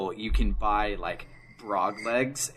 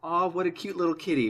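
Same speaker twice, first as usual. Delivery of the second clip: cooing; 7 semitones higher, faster than usual. Retold or imitated. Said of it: "Dave is like".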